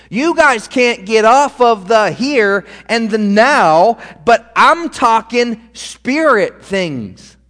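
slow, every word pressed home as a rebuke; emphatic preaching. Acted. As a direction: hammer each phrase at a crowd that won't listen. Preacher voicing Jesus' rebuke.

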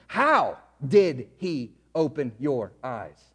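low, slow, drawn-out mock question; slow, low imitation of a scornful question; mocking Pharisee question. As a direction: draw it out like a sneering interrogator. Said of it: "look what they say".

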